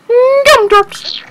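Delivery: sudden falsetto squeak; squeaky high voice. Pretend. Playing children's cake game.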